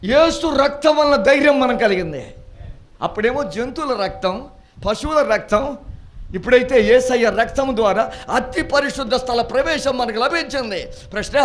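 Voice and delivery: loud, high, fervent preaching. Acted. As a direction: preach it out to the congregation. Preacher at full force. (గట్టిగా ఉత్సాహంగా ప్రసంగం)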